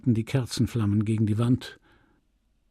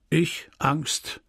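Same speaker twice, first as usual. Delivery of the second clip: shrugging retort; 3 semitones higher than usual. Acted. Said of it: "entgegnete er schulterzuckend".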